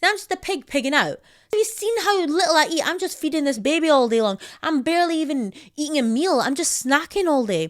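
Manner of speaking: high, loud wounded rant; hammed-up hurt indignation; mock tearful outrage. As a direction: play the offended new mum ranting. Acted tearful rant at husband.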